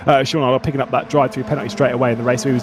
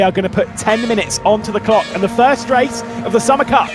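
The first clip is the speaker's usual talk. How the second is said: raised, energetic race play-by-play; motorsport commentator; 9 semitones higher than usual. Acted. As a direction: call the race start live and excited.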